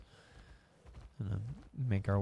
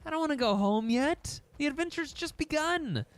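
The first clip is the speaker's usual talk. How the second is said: whiny friend voice; 13 semitones higher than usual. Retold or imitated. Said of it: "you're like".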